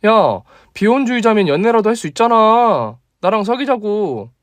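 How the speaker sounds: put-on bold young woman insisting; female friend demanding. Female colleague voiced. (높고 다그치는 여자 목소리)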